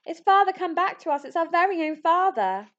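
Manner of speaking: high, loud overjoyed cries; joyful shouting. Acted. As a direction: shout it with tearful delight. Yetis greet returning father.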